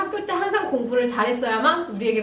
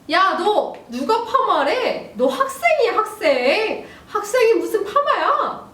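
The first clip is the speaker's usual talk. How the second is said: shrill, much higher outraged yell through laughter; angry father; 9 semitones higher, 4 dB louder, slower than usual. Acted. Dad scolds daughter for her perm, mock outrage. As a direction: overdo the shocked dad, half laughing. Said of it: "아빠".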